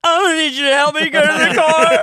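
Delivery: high, loud laughing protest; mock indignant yell. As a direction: shout it out, cracking up. Stoned, insisting again.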